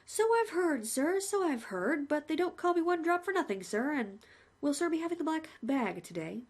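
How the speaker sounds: lightly voiced chatty executioner; a hint of a friendly hangman; the hangman greeting the condemned man, cheerfully; cheery hangman. Hangman speaks.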